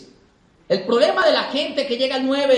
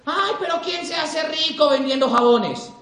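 higher, slow, drawn-out mocking objection; dismissive skeptic; 5 semitones higher, slower than usual. People brushing off a soap-selling business, dismissive. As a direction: scoff it out slowly in a raised voice. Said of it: "cuando la gente te dice".

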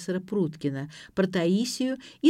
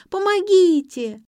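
high, loud, drawn-out cry; screaming for help; 13 semitones higher, 9 dB louder, slower than usual. A narrator's reading. Girl calls from the room for help, screaming high and slow. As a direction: stretch out a high shout for help. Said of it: "закричала из комнаты Лера".

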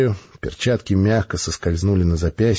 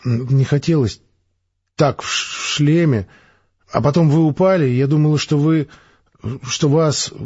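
put-on higher girl's voice, halting and breathless; a shaken girl stumbling over her words, higher; girl fumbling an explanation to the major, halting; girl struggling for words; 5 semitones higher than usual. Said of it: "Слова ещё давались ей с трудом".

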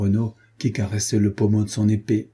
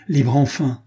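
libre enfin, with rising relief; joyful exclamation; 4 semitones higher, 4 dB louder than usual. A narrator's reading. Captain exclaims at his release, louder, relieved. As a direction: burst out with the freedom. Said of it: "répéta-t-il".

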